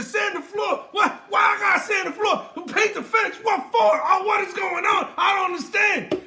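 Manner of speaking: high-pitched frustrated whine; whiny Daniel LaRusso. Retold or imitated. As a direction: complain like a frustrated kid. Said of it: "almost like Daniel LaRusso".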